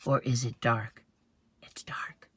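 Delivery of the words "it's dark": put-on hushed whisper to the children; soft storytime whisper. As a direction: breathe it like a secret. Reading to children, hushed aside.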